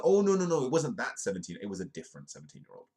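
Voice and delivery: high, loud mock protest settling down; hammed-up backtracking; mock correction. Mocks the article's correction.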